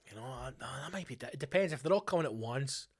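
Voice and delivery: usual speaking voice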